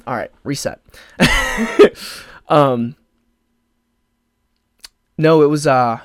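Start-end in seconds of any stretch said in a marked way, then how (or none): 1.2-2.2 high, breathy laugh of release; relieved laugh. Real, not acted. To her podcast audience after composing herself, a high relieved laugh. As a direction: laugh the tension away.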